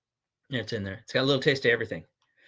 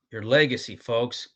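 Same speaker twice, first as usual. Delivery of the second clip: normal talking voice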